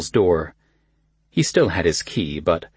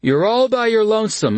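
cousin Kyle voice; 4 dB louder than usual. A narrator's reading.

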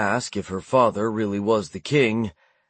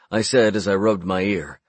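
plain reading voice

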